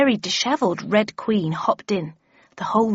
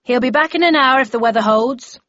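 raised, mildly projected voice; voice from the back; 4 semitones higher, 6 dB louder than usual. A narrator's reading. Someone at the back explains Shelley's absence, calling out. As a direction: call the news across the room. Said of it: "said a voice at the back".

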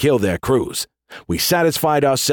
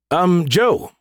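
high, tentative interjection; calling for attention; 7 semitones higher than usual. A narrator's reading. AI calling for the general's attention, high and tentative. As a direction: pipe up to get his attention. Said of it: "Skippy called for my attention".

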